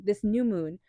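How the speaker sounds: ordinary delivery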